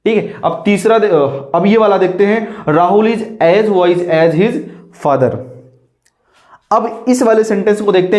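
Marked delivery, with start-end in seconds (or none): 2.7-5.4 slow dictation. Shown as a demonstration. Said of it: "teacher reads sentence aloud".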